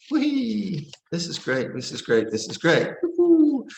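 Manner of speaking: high, giddy squeals of joy; giddy excitement. Retold or imitated. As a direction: play the thrilled beginner swept away by inspiration. Mimics excited inspired meditator.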